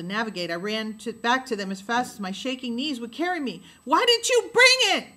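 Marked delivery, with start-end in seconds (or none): 3.8-5.1 high, loud demanding yell; a boy yelling at his sister. A narrator's reading. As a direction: yell the accusation. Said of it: "Jem yelled".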